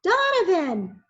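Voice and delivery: high, loud, slow call. A narrator's reading. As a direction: hail him across the room. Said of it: "called out".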